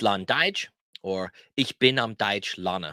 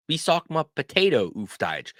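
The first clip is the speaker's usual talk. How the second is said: fluent, joined-up question, higher pitched; whole-question demo; 6 semitones higher than usual. Shown as a demonstration. Teacher models asking someone for a word, in one flow. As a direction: say it straight through in one flow. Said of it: "ask the question this way".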